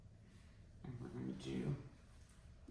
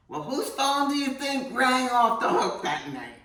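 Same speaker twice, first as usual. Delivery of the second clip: loud and higher, laughing through the punchline; bursting into laughter as she tells it; 9 semitones higher, 9 dB louder than usual. Real, not acted. Punchline of the ravioli story.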